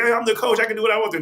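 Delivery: high-pitched mock retort; imitated arguing. Coach answers the general manager's demand, acted arguing. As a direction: act out a coach pushing back in an argument. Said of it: "They're sitting there in an argument".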